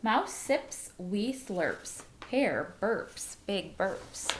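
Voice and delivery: slow, lilting read-aloud voice; story narration. A narrator's reading. Teacher reading a picture book to her class, slow and lilting. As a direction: read it slowly like a bedtime story.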